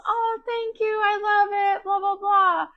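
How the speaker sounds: high, sing-song mock gracious thank-you; mock polite thanks. Mom mocking how the other family accepts presents, high sing-song. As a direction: chirp it up high, brushing off a gift politely. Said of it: "They're just".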